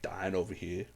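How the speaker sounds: mock-cheerful no worries, sinking to a sulk; sarcastic "no worries" from a hurt guy. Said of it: "it might be really sarcastic".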